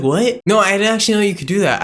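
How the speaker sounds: high, fast incredulous imitation; mock surprise. Seller hearing of client signings, mock astonishment. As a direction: blurt out fake disbelief.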